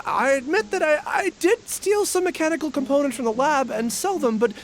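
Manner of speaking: a caught thief begging for mercy, words tumbling out; panicked pleading. Acted. Arrested suspect pleads, stammering panic.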